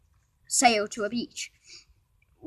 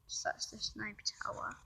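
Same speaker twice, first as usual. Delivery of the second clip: soft unvoiced whisper over the pictures; whispering to viewers while showing the drawings; whispered aside; 16 dB quieter than usual. Real, not acted. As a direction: whisper it as you point.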